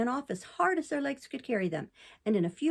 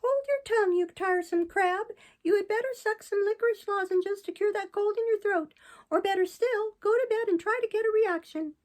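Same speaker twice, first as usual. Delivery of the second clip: high, louder cheeky puppet jeering at the crab; rude mocking puppet; 11 semitones higher, 4 dB louder than usual. A narrator's reading. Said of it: "looked at him mockingly and said rudely".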